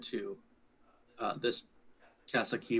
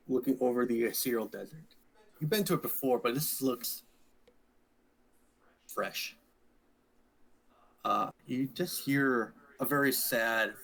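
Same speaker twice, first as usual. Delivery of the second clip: normal talking voice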